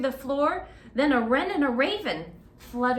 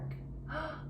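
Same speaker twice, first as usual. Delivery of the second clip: soft surprised gasp; playful gasp; excited gasp; 5 semitones higher, 10 dB quieter than usual. Pretend. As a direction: gasp in delight.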